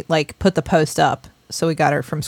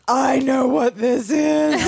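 put-on slow, higher, knowing kid voice; knowing child; 5 semitones higher, much slower than usual. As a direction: say it slowly like a kid who has figured it out.

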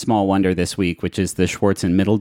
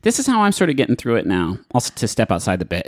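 ordinary talk

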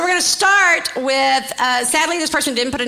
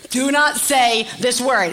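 slow, every word weighed; stern, deliberate no; slow serious warning; slower than usual. Real, not acted. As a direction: lay down the rule slowly. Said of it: "I am a hundred percent serious".

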